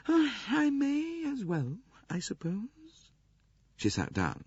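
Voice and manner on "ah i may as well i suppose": sighing. A narrator's reading. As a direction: give in with a weary old princess's sigh. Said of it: "She sighed".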